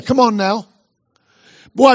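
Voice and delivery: ordinary talk, nothing marked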